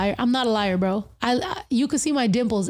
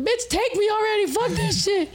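mock crying; 9 semitones higher than usual. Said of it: "I'm just, like, fucking crying".

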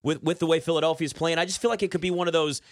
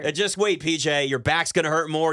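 usual speaking voice

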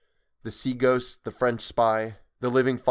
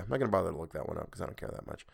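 plain reading voice

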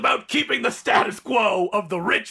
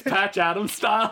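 normal talking voice

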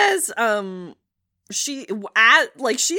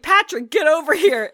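louder, acted dad calling his son; retold dad's summons; 3 semitones higher, 7 dB louder than usual. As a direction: call the boy over, a bit raised.

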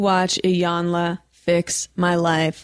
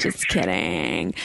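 deep joke voice; 14 semitones lower, 4 dB quieter, slower than usual. Acted. Teasing after being humiliated.

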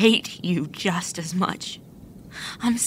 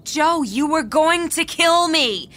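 outraged shout; 9 semitones higher than usual. Acted. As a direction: hurl it back at him. Georgia explodes at him.

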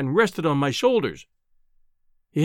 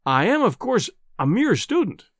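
high-pitched, simpering modesty; fatuous; 6 semitones higher than usual. A narrator's reading. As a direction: play the foolishly smiling humble student. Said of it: "with a fatuous smile".

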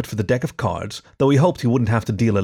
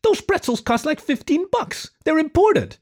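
very high, slow, indignant squeak; Perry fuming while pacing by the table. Acted. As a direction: wail about the price like it's a crime. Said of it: "Perry fumed".

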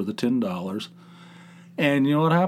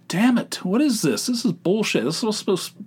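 higher-pitched, irritated self-quote; exasperated outburst; 8 semitones higher than usual. Retold or imitated. Gambler cursing his losing scratch-off, exasperated. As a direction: protest the losing ticket in mock outrage.

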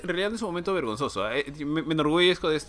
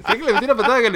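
laughing loud; 3 semitones higher, 11 dB louder than usual. Real, not acted. Telling friends about kicking his brother, loud and laughing. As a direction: crow it out with a laugh.

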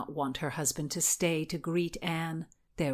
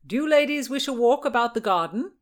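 raised, louder call from the doorway; 7 semitones higher, 6 dB louder than usual. A narrator's reading. Husband calls to the ladies from the door. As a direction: call across the room, pitch lifted. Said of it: "her husband called from the door".

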